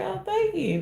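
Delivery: very high, sweet, airy thank-you; mock delighted thank-you; flattered thanks. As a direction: squeal a surprised thanks. Flattered reply to compliment.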